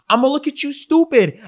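very high, loud incredulous outburst; loud disbelief. Acted. Salesman to a customer buying the wrong TV, loud incredulity. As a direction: exclaim it like the choice is absurd.

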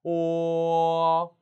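long level 오 with a slight 어 at the end; 오 gliding to 어. Shown as a demonstration. Teacher showing the vowel, 오 held and opening into 어. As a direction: start on 오, let it open toward 어. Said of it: "약간 어 발음이 나야 됩니다".

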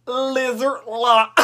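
ordinary delivery

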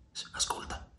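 hushed whispered warning. A narrator's reading. Sandokan hushing Yanez as men approach, whispered. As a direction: breathe it so no one outside hears.

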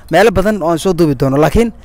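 ordinary talk

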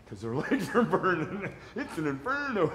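chuckling through the words; amused laughing aside. Real, not acted. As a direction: laugh it out while joking about the burn. Joking about wife's inferno workout.

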